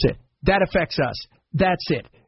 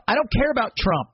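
ordinary talk

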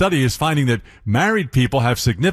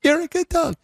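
much higher squeaky sing-song praise; extra-high dog baby talk. Shown as a demonstration. Host pushing his voice higher for the dog, squeaky sing-song. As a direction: push the pitch way up, cooing at a dog. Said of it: "I can't get high enough".

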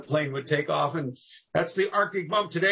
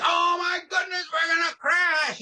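much higher and louder, frantic; panicked passenger shout; 13 semitones higher, 3 dB louder, slower than usual. Retold or imitated. Passenger yelling as sparks fly in the plane. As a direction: yell like you think the plane is going down. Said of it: "you'd say".

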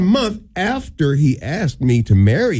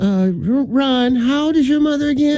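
lightly voiced old woman, higher; a hint of a nosy old lady; 9 semitones higher than usual. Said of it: "you're doing the old woman voice".

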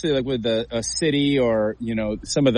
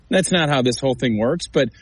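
normal talking voice

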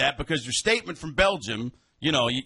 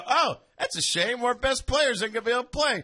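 high put-on voice of meek regret; mock resignation; 8 semitones higher than usual. Host imitating a president who would just sit by, mock meek. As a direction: feign helpless disappointment, pitched up high. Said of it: "just gonna sit there and go".

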